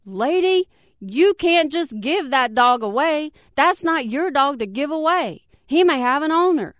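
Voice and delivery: mildly yelled scolding; mock scream. A narrator's reading. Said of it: "someone started screaming at me".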